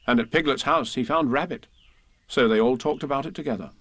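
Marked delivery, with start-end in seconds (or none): none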